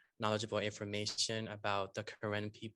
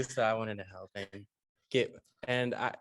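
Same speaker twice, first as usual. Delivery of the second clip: ordinary talk, nothing marked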